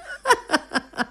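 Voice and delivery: a short real laugh at the joke; quick soft chuckle; amused chuckle. Real, not acted. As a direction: laugh it off warmly. Host laughs at listener's answer.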